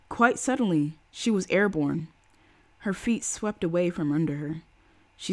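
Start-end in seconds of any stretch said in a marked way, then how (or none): none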